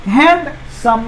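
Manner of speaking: Handsome slowly, with a gap after hand; split into syllables. Shown as a demonstration. Teacher showing it divides in two, split into syllables. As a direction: break handsome into two drawn-out beats. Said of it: "दो हिस्सा में बट रहा है".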